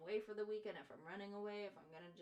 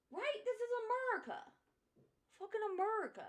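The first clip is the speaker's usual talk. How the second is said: high, loud hammed-up rant; mock patriotic yell; 14 semitones higher, 10 dB louder, faster than usual. Streamer ranting about America in a mock patriotic yell. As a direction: belt it like a parody patriot.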